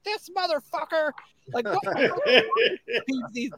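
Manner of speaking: high-pitched mock outrage; retold rant. Husband ranting to his wife at home, mock outrage.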